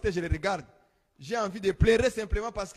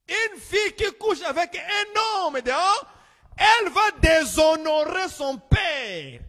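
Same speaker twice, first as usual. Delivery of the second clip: slow, higher and louder, driving each word home; forceful preaching; 6 semitones higher, 6 dB louder, slower than usual. Acted. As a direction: thunder it from the pulpit.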